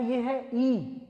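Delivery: "ई" as a short i, not "eye"; "ई" said short, never as "aai"; short i sound. Shown as a demonstration. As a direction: model "ई" as a short lax i. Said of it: "ये आई नहीं है".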